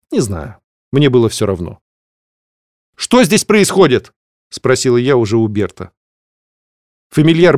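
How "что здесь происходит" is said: stern demand. Acted. Demanding explanation from Bert.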